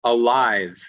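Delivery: "alive" ending on a clear, buzzed v; emphasised final V. Shown as a demonstration. Teacher giving an ending V example, clear final v. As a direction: finish "alive" fully on the v. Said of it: "using it at the end of a word".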